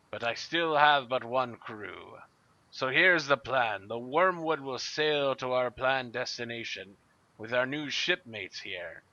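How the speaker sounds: gruff pirate captain rallying his crew, loud. Acted. Harrigan addresses the Wormwood's crew in a loud pirate captain voice. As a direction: bellow it like a pirate captain on deck.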